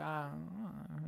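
slow, soft imitation of a sleepy girl; half-asleep. Sleeping girl answers about her passcode, half-asleep. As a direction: drag it out as if nodding off. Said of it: "And she's all sleeping".

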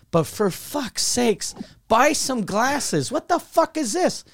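high-pitched, drawn-out mock exasperation; exasperated rant. Comedian complaining to resort about tiny glasses, exasperated rant. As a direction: stretch the complaint out, pitch climbing in disbelief.

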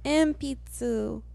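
put-on high, loud old lady student; old woman voice. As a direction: play an elderly student. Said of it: "Elderly class".

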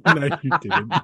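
laughing, can't believe it; laughing disbelief. Real, not acted. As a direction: laugh through the denial. Disbelief at co-host's confession.

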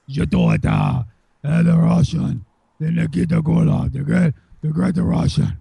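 low, throaty mock Russian accent; bad Russian accent. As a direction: put on a terrible, hammed-up Russian accent. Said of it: "if you're gonna do a Russian accent".

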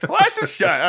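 put-on high, loud old-timey voice through a laugh; hammed-up saying from the twenties; twenties talk. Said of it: "talked about like that".

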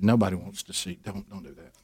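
imitating a wife's quiet telling-off; hushed warning. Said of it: "She had".

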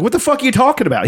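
high, fast, disbelieving yelp; incredulous outburst. Retold or imitated. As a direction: fire it off in shocked disbelief. Game character's outraged reaction.

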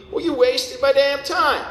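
high-pitched, drawn-out mock scolding; exasperated casting director. Casting director to an actor who can't do Russian, exasperated. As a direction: play a director indignantly scolding, high and slow.

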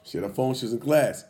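ordinary talk, nothing marked

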